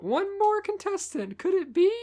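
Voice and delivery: put-on high girly voice; squeaky high girly voice; girly girl voice. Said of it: "make Dawn a girly girl".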